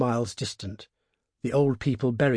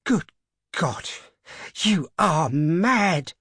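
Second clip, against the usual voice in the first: horrified cry, pitched up; appalled, stretching out the words; aghast; 6 semitones higher, 3 dB louder, much slower than usual. A narrator's reading. Old man horrified at his wife's plan, aghast. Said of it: "he cried aghast".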